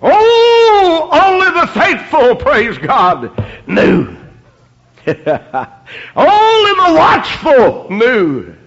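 fervent preacher cry; preaching. Acted. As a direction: lift the words up, preaching. Preaching at full force.

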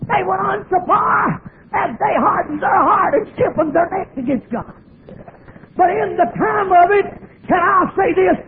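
rising, fervent preacher's cadence; preaching. Acted. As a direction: preach it to the pews. Preaching to the congregation.

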